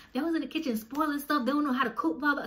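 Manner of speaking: high, indignant complaining; retold screaming rant. Ranting about roommates spoiling food, loud and indignant. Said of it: "So I started screaming in the house now".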